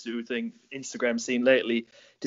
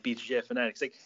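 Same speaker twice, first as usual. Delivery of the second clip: usual speaking voice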